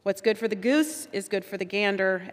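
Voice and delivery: usual speaking voice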